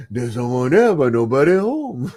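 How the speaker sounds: hammed-up Cajun rogue; Gambit voice. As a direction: savour it like Gambit. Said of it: "just doing his voice must be a real hoot".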